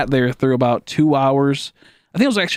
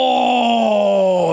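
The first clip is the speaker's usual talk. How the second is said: a pained, cry-like groaning oh, pitched up; anguished oh; 5 semitones higher than usual. Retold or imitated. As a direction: groan as the panic returns. Second wave of sickness hits.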